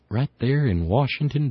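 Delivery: ordinary talk, nothing marked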